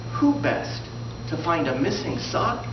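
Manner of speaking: normal talking voice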